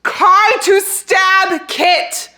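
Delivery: high, very loud, drawn-out shout; shocked scream. Real, not acted. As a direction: scream the twist word by word. Shocked reveal of stabbing.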